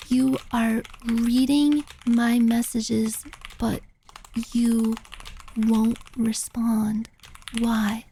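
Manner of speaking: slow, low, word-by-word delivery; halting and deliberate, pausing between words; menacing texter imitation. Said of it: "It was like that".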